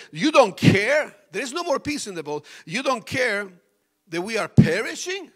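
loud high panicked cry; panicked disciples. Retold or imitated. Disciples crying out to Jesus in storm, loud panic. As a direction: shout it over the storm. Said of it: "And they say".

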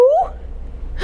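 a high airy gasp; Cat thrilled by the secret. Acted. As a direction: gasp with excitement. Cat gasps hearing secret.

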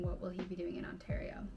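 usual speaking voice

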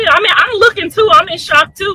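ordinary talk, nothing marked

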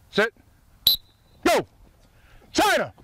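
high sharp shouted call; yelling from the sidelines. Real, not acted. Coach shouting call at drill.

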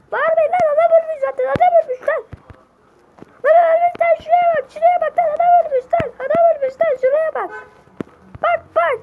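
very high, loud shrieking; frantic shouting. Acted. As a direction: yell in uproar over a dead man. Uproar over the dead man.

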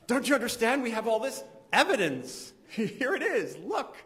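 high, pleading mock scientist voice; mock exasperated scientist. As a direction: play the frustrated scientist begging people to look.

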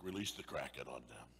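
low menacing mock villain; a hint of a villainous Satan. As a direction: growl it like a cartoon devil. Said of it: "Satan's like".

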